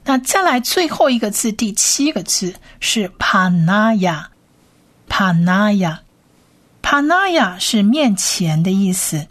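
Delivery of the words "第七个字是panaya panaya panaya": panaya stretched slowly, each syllable pa-na-ya clear; careful slow panaya; slow pronunciation demo. Shown as a demonstration. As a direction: drawl out panaya for learners.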